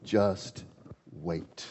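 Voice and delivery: low, soft and steady assurance; calm God. Acted. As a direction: settle him down gently. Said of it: "He says".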